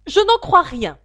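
high, loud, sharp questioning; suspicious pigeon. Acted. Pigeon interrogating Alice, high and suspicious. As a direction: demand it like a distrustful bird.

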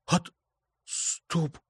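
barely squeezed out, quiet and numb; numb lips. A narrator's reading. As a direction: force the words past numb lips. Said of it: "еле выдавил онемевшими губами".

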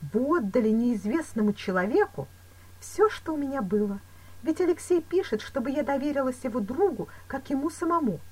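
a touch of agitated heroine; distressed young woman. A narrator's reading. Woman defending her trust in the stranger, agitated.

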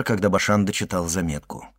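ordinary delivery, nothing marked

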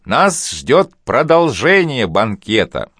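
slow, ringing announcement; booming call. Acted. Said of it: "крикнул зычным голосом".

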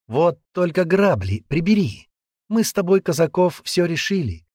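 high, firm rejection; rebuffing girl. Acted. Girl pushing away her ex, high firm rebuff.

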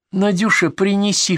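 high-pitched granny voice; old woman. Acted. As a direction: ask as a frail old patient. Old patient voiced in story.

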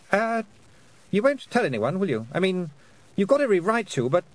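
high, halting plea; anxious boy. A narrator's reading. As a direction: ask nervously, backing off as you go. Nervous boy pleading for secrecy.

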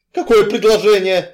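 angry, forceful demand; barked question. A narrator's reading. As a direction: snap the question out at full voice. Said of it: "рявкнул я".

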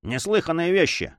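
high, mildly excited voice; agitated. A narrator's reading. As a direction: blurt it with a touch of agitation. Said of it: "ответил Дон Фернандо взволнованным голосом".

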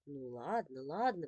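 low, soft soothing; calm her down gently; mother soothing her upset daughter softly; conciliatory. Acted. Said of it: "примирительно сказала мама".

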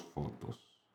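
whispered, fading out. A narrator's reading. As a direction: breathe the last word away. Repetición final susurrada.

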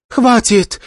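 high, desperate shout. Acted. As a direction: cry out to stop the fight. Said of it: "закричала Кинг Джао".

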